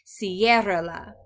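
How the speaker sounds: Ciérrala stressed on CIÉR, drawn out; slow Ciérrala with a loud first syllable. Shown as a demonstration. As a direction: punch and stretch the CIÉR in Ciérrala, keep rra-la light. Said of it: "the stress, the emphasis in the correct place".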